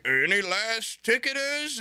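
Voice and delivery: drawn-out last call at the gate; gate agent call. Retold or imitated. Gate agent calling last passengers.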